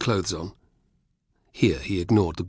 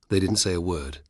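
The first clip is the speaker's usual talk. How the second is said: plain reading voice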